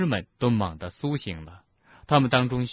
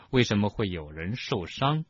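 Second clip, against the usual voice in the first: ordinary delivery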